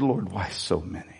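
soft, low plea to God; quiet lament. Retold or imitated. Pastor retelling his bedtime prayer, soft and low. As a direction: sigh the question out low. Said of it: "I said".

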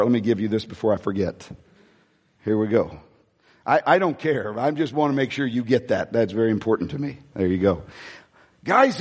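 normal talking voice

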